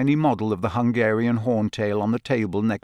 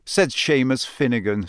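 ordinary delivery

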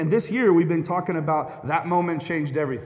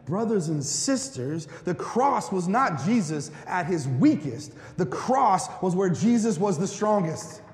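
slow, rising sermon emphasis, somewhat higher; preaching; 5 semitones higher, slower than usual. Acted. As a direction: build it slowly like a sermon peak. Preaching climax about the cross.

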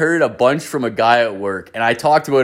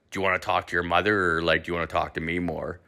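low, soft, quick dad voice; imitated subdued dad; 7 semitones lower, 8 dB quieter, faster than usual. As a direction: drop low and hurry through it. Dad winding down the phone call.

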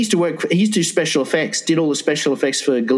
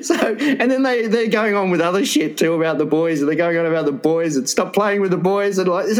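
ordinary talk, nothing marked

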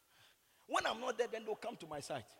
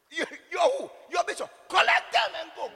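loud, raised-pitch challenge; shouted dismissal; 8 semitones higher, 14 dB louder than usual. Retold or imitated. Preacher voicing a man dismissing a bishop's messenger, shouted scornfully. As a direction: act out yelling at an unwelcome visitor.

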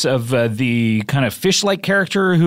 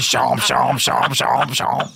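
snapping teeth; 3 semitones lower, much slower than usual. Retold or imitated. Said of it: "He comes in and says".

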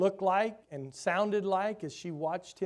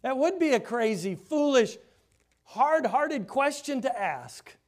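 higher, louder, slowing on the last words; emphatic preaching; 8 semitones higher, 7 dB louder than usual. Real, not acted. Preacher to congregation, scorning the question, loud. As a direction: hammer the foolishness of the question.

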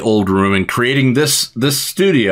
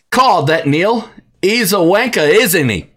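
put-on a shaky British accent that slides toward Australian; a lightly put-on British accent, pitched higher; mock British accent, leaning Australian; 6 semitones higher than usual. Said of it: "wanted me to say it in a British accent".

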